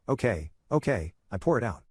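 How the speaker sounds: put-on slow, heavy Chinese accent; thick Chinese accent. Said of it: "in a thick Chinese accent".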